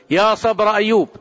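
imitating a lamenting cry; afflicted exclamation. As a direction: cry out like a stricken man. Person hit by calamity exclaims.

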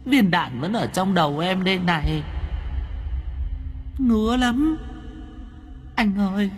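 put-on high, eerie girl ghost voice; high ghostly plea. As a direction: coax him like a dead girl. Ghost girl speaks to Cường.

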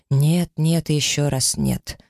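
slow, insistent triple no; cutting interruption. A narrator's reading. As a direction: lean on each нет, slowly. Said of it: "перебил принц".

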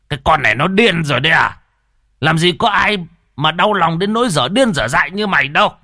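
high, loud enraged yell; a grandfather shouting at his unhinged daughter; furious grandfather. Acted. Grandfather yelling at his grieving daughter, furious shout. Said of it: "Ông ngoại nổi khùng".

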